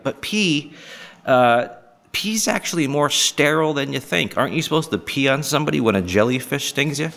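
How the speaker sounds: ordinary delivery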